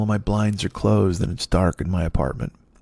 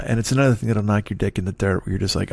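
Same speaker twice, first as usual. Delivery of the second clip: ordinary talk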